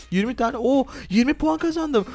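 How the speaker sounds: high, delighted exclamation; excited. Real, not acted. Player to friend on winning points, excited. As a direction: burst out at a sudden win.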